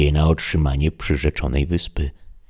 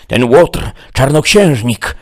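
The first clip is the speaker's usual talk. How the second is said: much higher, louder, grandiose knight; Don Quixote the knight; 10 semitones higher, 8 dB louder than usual. Acted. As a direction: declaim it like a deluded hero. Don Quixote answers Sancho.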